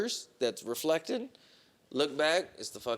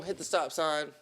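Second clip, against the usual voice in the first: ordinary delivery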